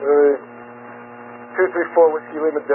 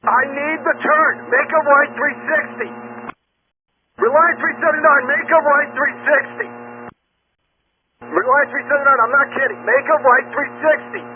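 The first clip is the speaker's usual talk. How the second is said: raised, insistent commands; urgent; 6 semitones higher than usual. Real, not acted. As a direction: demand it, exasperated and urgent. Controller repeating urgent order.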